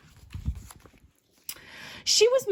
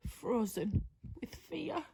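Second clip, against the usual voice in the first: whisper; 2 semitones lower, 11 dB quieter than usual. A narrator's reading. Fearful narration line.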